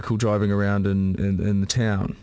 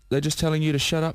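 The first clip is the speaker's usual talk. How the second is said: ordinary talk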